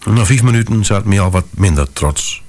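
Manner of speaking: plain reading voice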